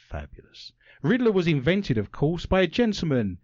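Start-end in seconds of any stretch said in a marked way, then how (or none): 0.1-0.7 whispered aside. Acted. Breathy aside after joke.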